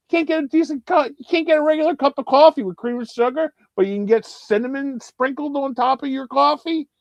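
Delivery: high-pitched comic rant; incredulous high-pitched griping; indignant retold complaint. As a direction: rattle off the complaint, voice way up. Acted complaint at coffee shop.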